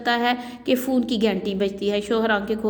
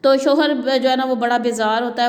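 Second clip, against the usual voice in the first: usual speaking voice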